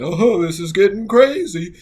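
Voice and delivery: high, loud mock-panicked cry; alarmed exclamation. Two deckhands cry out to their captain in a storm, alarmed. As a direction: call out over a storm, voice jumping up.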